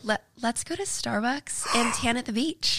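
a mild American accent, slow and careful; American accent impression. Shown as a demonstration. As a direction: reads the line with a light American accent. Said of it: "your best American accent impression".

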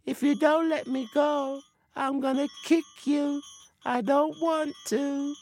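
high-voiced spirit pleading and warning; trapped spirit voice. A narrator's reading. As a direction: threaten in a high, thin spirit voice. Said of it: "now the figure said".

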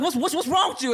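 high-pitched, stammered mock challenge; pissed-off friend. Storyteller imitates Rachel confronting the girl, mock pissed-off. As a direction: imitate an angry friend, stuttering on the first word. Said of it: "Rachel is pissed, about to pop off".

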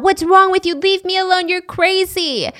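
high, held-back mock scream; acted distress pitched high, slowing on the last words; retold scream. Girl trying to get out, imitated screaming. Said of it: "And she's screaming".

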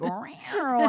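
high, loud cat meow; stomach meow. Retold or imitated. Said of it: "it was like".

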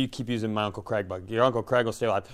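normal talking voice

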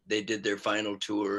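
normal talking voice

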